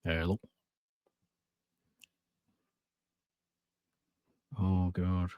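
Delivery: choppy, distant audio dropping out; bad connection. Real, not acted. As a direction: voice breaking up over a bad line. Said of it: "My internet went out".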